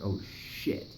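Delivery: breathless whispered oh shit; startled whisper on seeing the gun; whispered shock. Retold or imitated. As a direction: whisper it as you spot the gun. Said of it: "And I was like".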